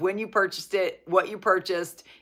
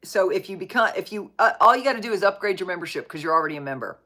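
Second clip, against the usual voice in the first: ordinary talk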